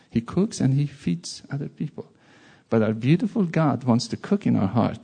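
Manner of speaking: ordinary talk, nothing marked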